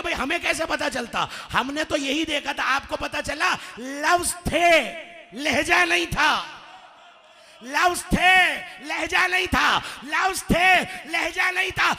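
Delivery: high, shouted retort, building in force; impassioned acted protest. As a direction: cry out the defence, hammering each phrase. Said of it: "कहने लगे". (ऊँची आवाज़ में जोशीली सफ़ाई)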